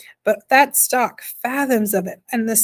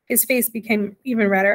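ordinary delivery, nothing marked

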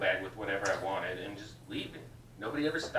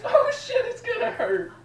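mock pained yelp; 7 dB louder than usual. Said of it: "it's like".

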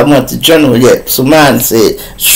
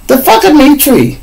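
put-on girlfriend's voice; 13 semitones higher than usual. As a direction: play her excited and eager, pitched way up. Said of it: "I'm like".

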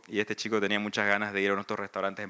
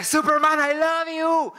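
chillido agudo y fuerte de fan; grítale tu amor como fan enloquecido; fan histérico; 6 dB louder, slower than usual. Retold or imitated. Fan gritando a Henry Cavill. (chillido de fan enamorado)

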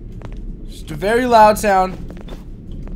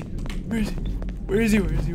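a tense whispered "Where is he?"; hushed, breathless question; 3 semitones lower, 9 dB quieter than usual. Real, not acted. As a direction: whisper it so the monster won't hear. Searching quietly for monster.

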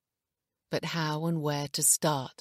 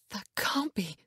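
breathy, higher-pitched moan; 4 semitones higher than usual. A narrator's reading. Wife shown the paternity test, moaning. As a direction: moan it in stunned disbelief. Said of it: "she moaned".

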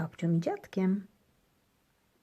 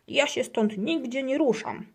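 higher, firm refusal; stubborn grumble; 7 semitones higher, 4 dB louder than usual. A narrator's reading. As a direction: dig in and refuse. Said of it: "burczy Gryzek".

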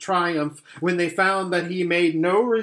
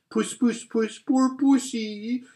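jeering; 9 semitones higher than usual. Acted. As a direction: taunt him like calling a cat. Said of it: "jeering at him".

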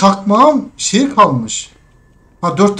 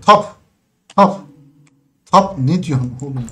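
ordinary talk

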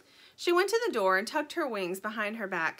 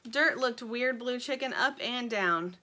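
ordinary delivery

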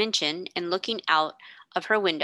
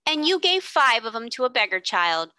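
bun woman breaking in, a touch louder; eager interruption; 5 semitones higher, 5 dB louder than usual. A narrator's reading. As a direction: cut in eagerly, as the shop woman.

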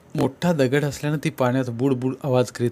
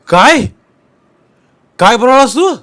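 high, loud stunned cry of disbelief; shocked outburst; 9 semitones higher, 10 dB louder than usual. Acted. Sonya hit by the murder accusation, shocked loud cry. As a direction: jolt up loud as if struck. Accused of killing his mother.

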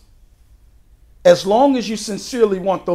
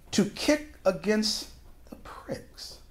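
whispered scripture; 12 dB quieter, much slower than usual. Acted. Scripture line dropped to whisper.

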